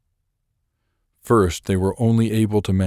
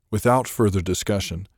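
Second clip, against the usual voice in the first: ordinary delivery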